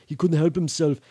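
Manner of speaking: ordinary delivery, nothing marked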